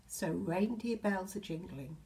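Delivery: ordinary delivery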